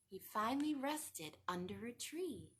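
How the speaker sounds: ordinary delivery, nothing marked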